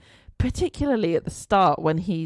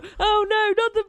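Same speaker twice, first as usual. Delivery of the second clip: high, loud panicked cries; panicked shouting; 5 dB louder than usual. Retold or imitated. Watching the kitchen scene, shouting in high mock panic. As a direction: yell at the screen in mock terror. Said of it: "I was literally going".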